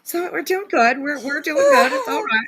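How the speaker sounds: high, laughing speech. Real, not acted. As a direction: talk through a laughing fit. Laughing fit.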